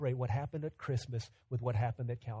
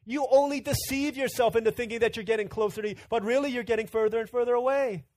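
higher, louder stern rebuke; God's rebuke; 8 semitones higher, 5 dB louder than usual. Retold or imitated. Preacher voicing God to the congregation, louder rebuke. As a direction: preach God's words with force. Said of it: "because he says".

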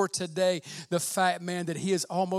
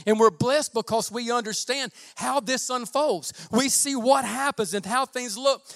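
louder, driving preacher's build; preaching at full force; 6 semitones higher, 8 dB louder than usual. Acted. Pastor warning the church about coming deception, preaching loud. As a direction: preach it hard to the room.

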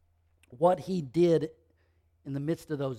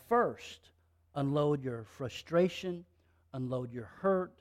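ordinary talk

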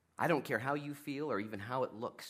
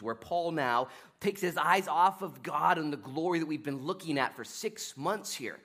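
ordinary talk

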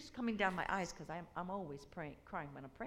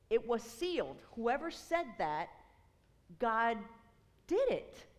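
preaching with force; 2 semitones higher, 6 dB louder than usual. Real, not acted. Preacher proclaiming to the congregation that God did it, loud and rising.

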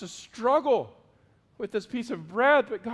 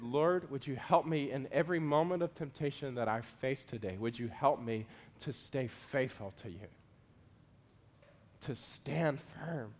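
prayer; 8 semitones lower, 8 dB quieter than usual. Shown as a demonstration. Quoted prayer in sermon.

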